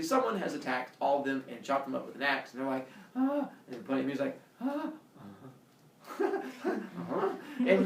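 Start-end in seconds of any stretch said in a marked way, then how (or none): 3.2-3.5 short high-pitched gasp; horrified gasp. Retold or imitated. Townspeople gasp in horror. 4.6-4.9 short high-pitched gasp; horrified gasp. Retold or imitated. Second onlooker gasps.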